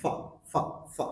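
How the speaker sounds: "ফা" as a very short /fə/; weak form. Shown as a demonstration. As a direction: say "ফা" with a reduced uh vowel. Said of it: "উইক সাউন্ডে sound আসছে". ("ফা" খুব ছোট করে)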